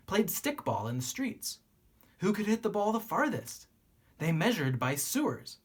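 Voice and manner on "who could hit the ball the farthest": usual speaking voice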